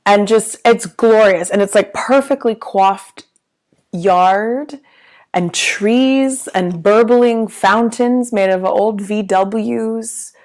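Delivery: ordinary delivery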